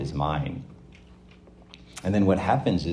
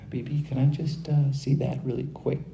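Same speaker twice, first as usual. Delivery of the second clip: high, gentle coaxing parent voice; 8 semitones higher than usual. Acted. Parent coaxing a child to hand over his toy, high and gentle. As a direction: sweetly coax a toddler into sharing.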